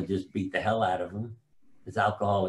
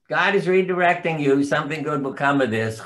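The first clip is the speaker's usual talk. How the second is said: higher, louder imitation of mother; mother in the kitchen; 5 semitones higher, 4 dB louder than usual. Mother calling advice from the kitchen to her kid, imitated sing-out. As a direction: call it out like an unruffled mother. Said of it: "not her in the kitchen saying".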